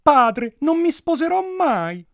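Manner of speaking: high, loud princess's refusal; defiant princess. A narrator's reading. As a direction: declare it at the top of your voice. Princess defies her father.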